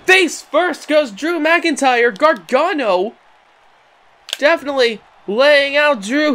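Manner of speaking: high, heated wrestling commentary; excited play-by-play. Acted. Commentator calling a roll-up and cover, in excited play-by-play. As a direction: call the action with rising excitement.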